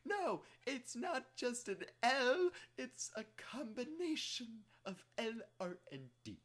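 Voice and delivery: high, loud mock-pedantic correction; high-pitched mock lecturing voice; mock Japanese scholar. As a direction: squeak it out like a fussy know-it-all. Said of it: "they're like".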